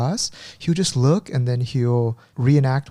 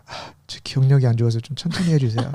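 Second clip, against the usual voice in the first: a quick polite plea in Korean; quick polite request; much faster than usual. Retold or imitated. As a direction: rush through the apology. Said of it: "I always tell them".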